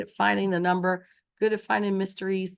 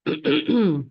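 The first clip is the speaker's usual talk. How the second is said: throat clearing; 3 semitones lower, 5 dB louder than usual. Real, not acted.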